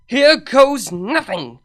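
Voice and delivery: loud, slow, determined yell. A narrator's reading. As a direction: hurl it out with all her might. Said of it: "she yelled".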